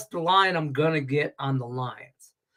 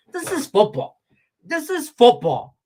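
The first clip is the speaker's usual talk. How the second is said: emphatic shout; 8 semitones higher, 5 dB louder than usual. Real, not acted. Ranting at Mahomes, loud and emphatic. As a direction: pound it home.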